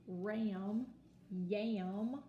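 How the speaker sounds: ordinary talk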